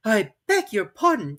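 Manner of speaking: polite but firm; firmly. Acted. Alice answering the mouse, slow and firm. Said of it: "said Alice very firmly".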